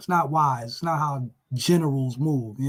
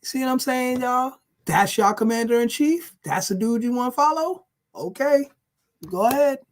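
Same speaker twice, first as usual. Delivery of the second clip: sarcastic; 6 semitones higher than usual. Real, not acted. Sarcastic appeal to audience.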